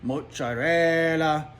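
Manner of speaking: hammed-up foodie relish; savoring. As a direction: linger lovingly on every syllable. Savoring a list of sandwich foods.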